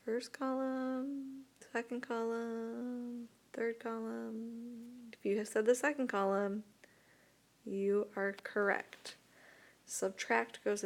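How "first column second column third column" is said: slow, drawn-out listing of the options; slow choices. Shown as a demonstration. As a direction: lay out each choice slowly for the class to pick. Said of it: "where would subtract go".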